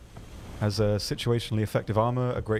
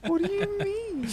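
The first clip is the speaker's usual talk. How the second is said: squeaky, much higher outraged question; mock indignation; slower than usual. Real, not acted. Teased by his co-host, squeaking mock outrage. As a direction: play up being offended by the jab. Teased about language overloading brain.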